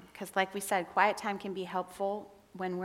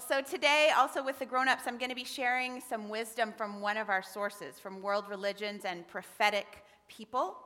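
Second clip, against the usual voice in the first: ordinary talk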